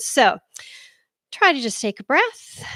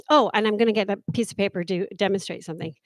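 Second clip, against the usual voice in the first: quick, softer mumble to oneself; mumbling demo; 4 semitones lower, 6 dB quieter, much faster than usual. Shown as a demonstration. Coach demonstrating mumbling to the audience. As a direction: mutter it down into the paper. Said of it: "mumbling, such as".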